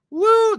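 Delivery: high, loud "woo"; celebratory whoop. Real, not acted. Celebrating the hype train, high loud whoop.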